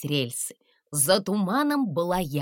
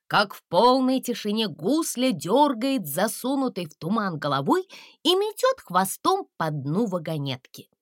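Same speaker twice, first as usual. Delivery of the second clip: plain reading voice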